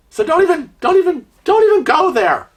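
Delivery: high, loud don't even go there; exasperated. Real, not acted. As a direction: cut them off sharply, fed up. Exasperated warning to viewers.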